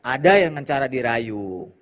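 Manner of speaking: ordinary talk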